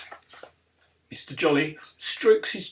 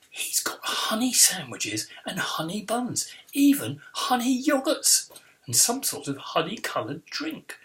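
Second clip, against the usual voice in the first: ordinary delivery, nothing marked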